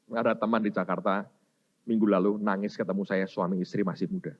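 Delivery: ordinary delivery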